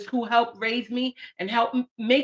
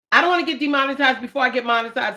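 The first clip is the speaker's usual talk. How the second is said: usual speaking voice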